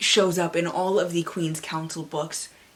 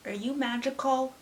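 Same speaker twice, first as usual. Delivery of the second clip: curious Belle; 6 semitones higher, 4 dB quieter than usual. Acted. Belle addressing the magic mirror.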